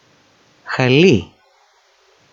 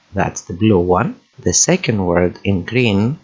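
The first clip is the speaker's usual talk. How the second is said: ordinary talk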